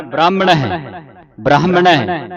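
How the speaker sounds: ਬ੍ਰਾਹਮਣ ਹੈ with the ਹ clearly sounded, a light laugh; correct pronunciation. Shown as a demonstration. Teacher showing students the aspirated ਬ੍ਰਾਹਮਣ ਹੈ, with a chuckle. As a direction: say ਬ੍ਰਾਹਮਣ ਹੈ sounding both h's. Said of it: "ਹਾਹੇ ਦੇ ਉਚਾਰਨ ਕਰਨਾ ਹੈ".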